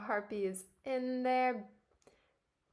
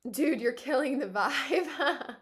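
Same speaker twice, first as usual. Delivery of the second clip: laughing; 4 semitones higher, 5 dB louder, slower than usual. Acted. Laughing reading character line.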